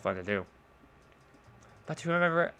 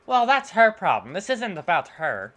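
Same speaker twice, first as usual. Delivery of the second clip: put-on angry girl voice; 9 dB louder than usual. Natsuki voice.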